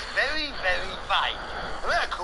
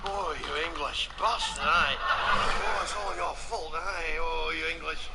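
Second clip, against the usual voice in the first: pleasured Scotsman; 4 semitones lower, slower than usual. Acted. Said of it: "sounds like he's having his genitals rubbed".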